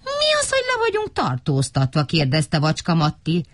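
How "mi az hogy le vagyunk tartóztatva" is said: very high squeaky question; piping, incredulous little voice; astonished small character. A narrator's reading. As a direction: ask in high-pitched bafflement.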